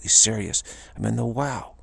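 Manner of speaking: normal talking voice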